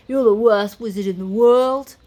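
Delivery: loud, outraged Scottish roar. A narrator's reading. Spoon rounds on the useless wizard, roaring. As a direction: roar the insult at full voice. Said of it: "roared Spoon".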